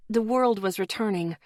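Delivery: ordinary delivery, nothing marked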